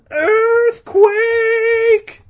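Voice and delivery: piercing high scream of alarm; hammed-up screeching panic. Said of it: "Todd screamed".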